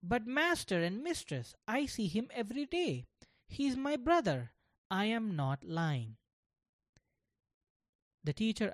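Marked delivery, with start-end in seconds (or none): 0.0-6.2 lightly voiced young boy, higher; a hint of an earnest little boy speaking up; a child's voice. Gopal insists to teacher.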